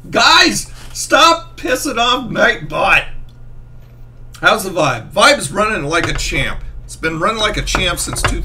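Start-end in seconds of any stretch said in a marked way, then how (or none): 0.1-3.1 raised voice, slow and firm; loud scolding call. Real, not acted. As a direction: call out the chat sternly. Calling out chat.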